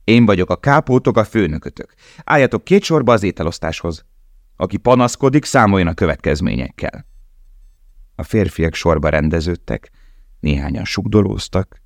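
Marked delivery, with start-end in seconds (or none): 0.0-7.1 higher, harsh boss voice; domineering kapo. Acted. Kapo declaring himself boss to prisoners, domineering. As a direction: lord it over the new men.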